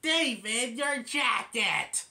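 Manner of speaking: put-on high, loud, slow old lady call; Miss Miller calling out. Miss Miller calling to David about his jacket, high and loud. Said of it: "Miss Miller then goes".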